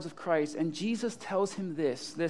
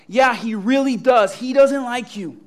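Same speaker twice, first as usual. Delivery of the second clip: loud, raised, matter-of-course reply; emphatic confirmation; 4 semitones higher, 11 dB louder than usual. Acted. Imagined Jesus confirms to Peter, loud and emphatic. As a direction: confirm it with full comic emphasis.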